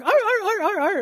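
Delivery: high mock dog yelps; dog yelping. Preacher imitates the dog that got hit, high yelps. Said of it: "They're going".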